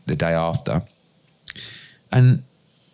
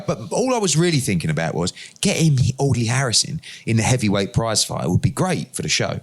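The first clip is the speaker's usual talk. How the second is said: usual speaking voice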